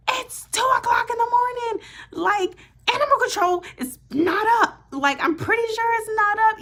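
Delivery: incredulous at a dumb suggestion; retold exasperation. Caller to dispatcher about animal control, shrill disbelief.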